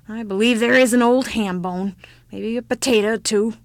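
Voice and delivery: loud, lightly voiced old woman; relenting old woman.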